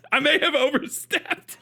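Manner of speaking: very high, squeaky laughing voice; helpless laughing. Real, not acted. Streamer apologizing for overstepping, helpless with laughter. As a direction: squeeze the words out through real laughter.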